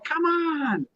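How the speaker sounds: high exasperated "come on"; frustrated cry. Retold or imitated. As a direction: squeal it out in frustration. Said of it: "A full meltdown behind the scenes going".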